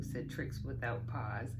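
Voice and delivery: usual speaking voice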